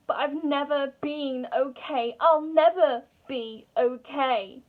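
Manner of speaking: loud, slow cry of despair; despairing outburst. Acted. Monologue breaks into despairing outburst.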